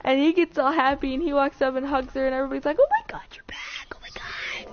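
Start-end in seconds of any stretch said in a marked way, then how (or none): 2.8-4.7 high, breathless whispered squeal, trailing away; mock excited whisper. Friends welcome the missing girl back, mock excited whisper. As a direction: act out a thrilled, hushed welcome. Everybody greets the returning girl.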